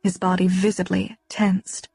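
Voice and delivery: ordinary delivery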